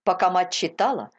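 ordinary delivery

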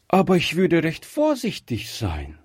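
put-on a curious little girl scolding gently; child Gretchen. A narrator's reading. Gretchen questions the old shopkeeper, high and childlike.

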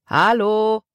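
drawn-out hello held on a high level note; called out. A narrator's reading. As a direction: hail the ship, holding the last vowel. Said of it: "rief der Mann noch einmal". (lang gezogen gerufen)

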